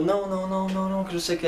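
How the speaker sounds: rapid string of high mock cries; imitated screaming protest. Entity making a scene while being dragged out, mock screaming. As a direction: imitate frantic shrieking without real volume. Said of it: "Aí começou a gritar".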